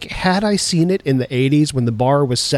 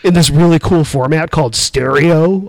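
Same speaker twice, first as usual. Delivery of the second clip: mock old-timer; 4 semitones higher, 4 dB louder than usual. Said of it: "Slow down, Grandpa".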